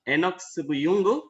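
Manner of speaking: normal talking voice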